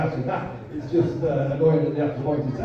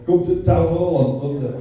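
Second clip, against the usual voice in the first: a hint of a broad cartoon Yorkshire radio advert, half laughing; mock radio advert in a cartoon Yorkshire voice; 2 semitones lower than usual. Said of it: "that cartoon Yorkshire voice filled the air".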